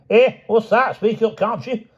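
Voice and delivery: put-on high, loud, cranky old man's voice; a testy, half-deaf old man. A narrator's reading. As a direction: bark it like a deaf old codger.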